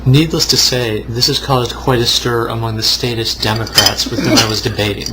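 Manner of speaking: ordinary delivery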